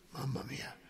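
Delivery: a breathless whispered mamma mia; awed whisper. Real, not acted. Preacher marvels to the congregation in a whisper. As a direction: whisper it in awe.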